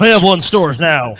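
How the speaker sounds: loud announcer tagline; mock ad read. Joking about a video game, mock loud ad read. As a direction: sell it like a TV ad, loud.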